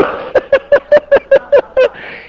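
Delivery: high, loud burst of laughter; loud laugh at his own punchline. Real, not acted. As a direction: laugh out hard. Laughs after the story.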